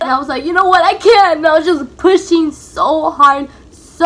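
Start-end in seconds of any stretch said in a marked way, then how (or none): 0.4-1.4 loud resolved you know what I can; determined burst. Retold or imitated. Mother answering the nurse with sudden determination, loudly. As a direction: fire herself up to push.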